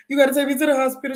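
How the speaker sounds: fast, high frightened begging; panicked plea. Retold or imitated. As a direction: beg in a rush of panic. Crying child begs grandma.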